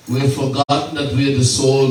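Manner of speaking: ordinary delivery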